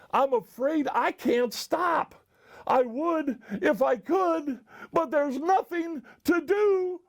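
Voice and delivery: high-pitched, rising urgency; helpless panic. A narrator's reading. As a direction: elephant losing the fight with a sneeze. Elephant about to sneeze.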